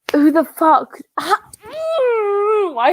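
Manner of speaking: high exasperated cry, breaking into a squeal; real angry, wordless high strain; angry outburst. Real, not acted. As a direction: blurt it out in frustration. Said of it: "Charlotte getting so angry at Chelsea".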